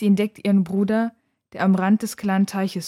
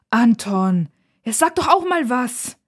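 high, raised wifely complaint; agitated, higher-pitched appeal; upset, exasperated; 4 semitones higher, 5 dB louder, slower than usual. A narrator's reading. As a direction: demand backup from your husband. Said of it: "sagt ihre Mutter aufgebracht".

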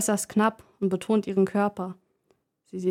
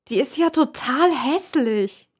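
higher, slow shrill scold; shrill nagging; 5 semitones higher, 3 dB louder, slower than usual. Acted. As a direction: spit it out with contempt. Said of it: "keift sie". (keifend, höher und langsam)

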